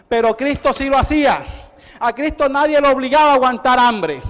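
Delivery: loud, rising preacher's cadence; preaching. Acted. Preacher to congregation, loud fervent preaching.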